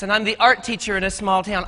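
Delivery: ordinary delivery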